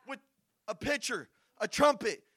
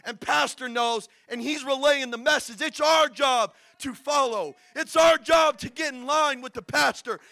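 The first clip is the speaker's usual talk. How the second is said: loud, insistent pulpit exhortation; forceful sermon building to a shout; preaching at full force; 2 semitones higher, 8 dB louder than usual. Real, not acted. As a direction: urge the congregation, punching key words.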